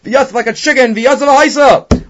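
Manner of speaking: high, loud imitation of screaming; mock scream. Teacher acting out Reish Lakish's outburst to students, mock screaming. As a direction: raise pitch and volume into a hammed-up yell. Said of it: "Reish Lakish started screaming".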